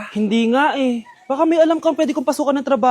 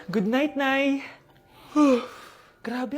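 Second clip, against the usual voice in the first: ordinary talk, nothing marked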